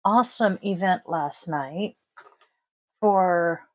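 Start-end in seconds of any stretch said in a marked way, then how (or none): none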